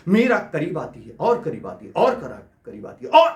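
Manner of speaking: building suspense. Acted. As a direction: build breathlessly towards the climax, step by step. Narrating a suspenseful approach, building. (बढ़ता हुआ रोमांच)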